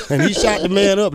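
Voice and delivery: usual speaking voice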